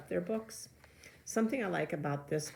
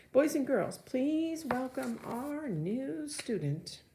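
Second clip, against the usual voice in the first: teacher's welcome; 9 semitones higher, slower than usual. A narrator's reading. Teacher welcoming a new student to the class, high and cheery. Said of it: "Missus Beam says".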